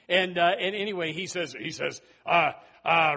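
normal talking voice

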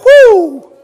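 high, loud whoop of relief; joyful whoo. Pretend. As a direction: whoop like you just made it into heaven. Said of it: "you're gonna go".

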